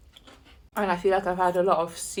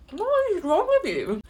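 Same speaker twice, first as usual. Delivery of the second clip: high-pitched, incredulous demand; retold outrage, pitched way up; appalled retort; 11 semitones higher than usual. Dog owner confronting a woman about to kick her dog, appalled. Acted argument over kicking dog.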